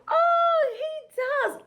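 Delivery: sharp gasp then a very high oh; big storybook astonishment; surprised gasp. Real, not acted. Mom surprised at bear exercising.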